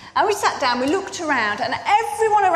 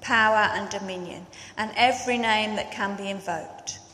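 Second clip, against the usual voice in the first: scripture reading; 2 semitones lower, 5 dB quieter than usual. Acted. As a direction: read the passage with solemn weight. Reads scripture before the sermon.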